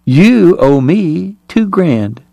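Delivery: slow, high, drawn-out purr. A narrator's reading. Kate claiming her winnings from Greer, purring. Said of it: "Kate purred".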